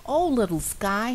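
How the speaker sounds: high, loud amazed gasp. Acted. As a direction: gasp it in wonder. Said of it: "he gasped".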